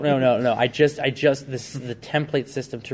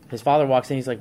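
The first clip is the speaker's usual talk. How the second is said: ordinary talk, nothing marked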